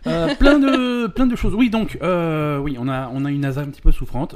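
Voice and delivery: hyped, high and loud; bouncing off the walls; too much caffeine. Real, not acted. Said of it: "Ca-, calme-toi".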